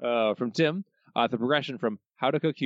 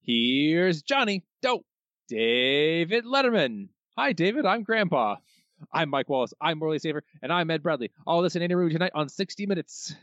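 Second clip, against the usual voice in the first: announcer impression; 7 semitones higher than usual. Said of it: "from [name]".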